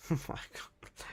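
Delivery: breathless whisper. Real, not acted. Streamer stunned after a laugh, whispering. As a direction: let it out on a breath.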